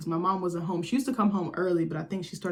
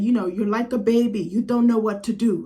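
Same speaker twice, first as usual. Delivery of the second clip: louder, scolding like an annoyed guardian; agitated scolding; 2 semitones higher, 6 dB louder than usual. Retold or imitated. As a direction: scold him like a helpless baby. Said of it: "she was so agitated".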